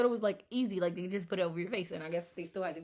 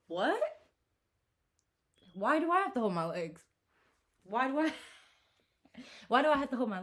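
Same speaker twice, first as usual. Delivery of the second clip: louder, higher indignant outrage; a mild replay of her fury; retold anger; 5 semitones higher, 6 dB louder than usual. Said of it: "I was so angry".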